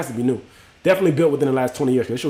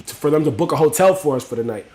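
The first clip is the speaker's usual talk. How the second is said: ordinary delivery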